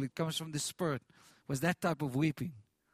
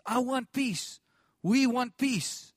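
retold cry; 5 semitones higher, 4 dB louder, slower than usual. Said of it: "She shouted out".

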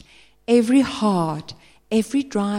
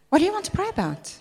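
incredulous; 9 semitones higher, much faster than usual. Retold or imitated. Pastor quoting his own retort.

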